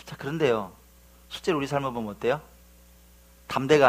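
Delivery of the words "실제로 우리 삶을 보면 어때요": ordinary delivery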